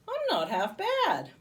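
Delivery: high, slow, loud self-admiring; preening. A narrator's reading. Bob admiring his reflection to make her laugh. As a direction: ham it up for the mirror.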